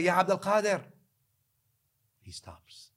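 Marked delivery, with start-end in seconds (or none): 0.0-0.8 high call to someone leaving. Retold or imitated. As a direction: call after him. Drunkard calls again.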